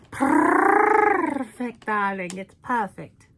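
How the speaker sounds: camp diva drawling darling; breathy glamour star cooing praise. Acted. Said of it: "I sounded like [name]".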